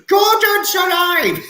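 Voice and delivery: high, drawn-out booming cry; Brian Blessed. Acted. Repeating a famous actor's catchphrase, booming cry. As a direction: bellow it slowly, on the edge of laughter. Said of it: "that's Brian Blessed for you".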